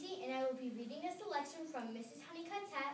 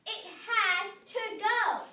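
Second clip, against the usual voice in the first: loud, very slow, higher declaration; declaring; 8 semitones higher, 9 dB louder, much slower than usual. A narrator's reading. Missus McSnoot declaring to her neighbor, loud and slow. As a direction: lay down the law slowly and loudly. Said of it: "declared Missus McSnoot".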